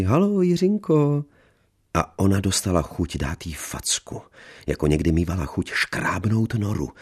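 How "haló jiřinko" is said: much higher, sugary condescending greeting; motherly hello to the younger woman. A narrator's reading. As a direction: greet her like a patronising mother. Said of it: "řekla mateřsky".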